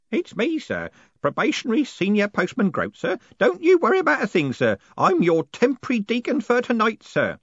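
put-on high-pitched, deferential old postman; eager elderly underling in a high voice; Groat, eager old postman. A narrator's reading. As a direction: pitch it high and fussy, reassuring a superior.

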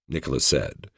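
ordinary delivery

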